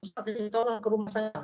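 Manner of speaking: a touch of a weeping woman begging for help; woman calling the ustaz late at night, mildly tearful and fast; imitated tearful plea. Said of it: "nangis-nangis".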